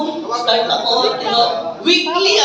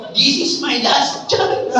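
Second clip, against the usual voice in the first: slow, laughing through the words; laughing self-quote; 3 semitones higher, slower than usual. Retold or imitated. Recalling her last chance to the audience, slow and laughing. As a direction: chuckle as you recall the moment. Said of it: "Sabi ko".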